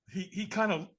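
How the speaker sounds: ordinary talk, nothing marked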